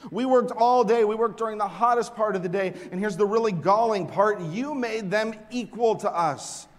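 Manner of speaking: loud, high, aggrieved protest; grumbling complaint. Acted. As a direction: hurl the complaint at the master. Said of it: "they grumbled at the master".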